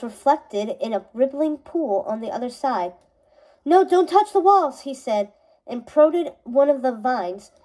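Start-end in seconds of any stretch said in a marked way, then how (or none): 3.6-4.8 raised, urgent call; sharp warning, pitched up. A narrator's reading. Warning shout at Briar Rose.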